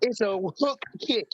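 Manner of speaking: mock insistence. Mom corrects the commentators' name for the kick, insistent.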